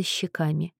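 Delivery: ordinary delivery, nothing marked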